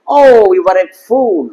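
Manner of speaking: very slow sentence, FOOL stretched to 'foool'; slow model sentence with a long FOOL; long 'oo' in fool. Shown as a demonstration. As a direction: drag out OH and the vowel of FOOL. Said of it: "এটা দীর্ঘ উ".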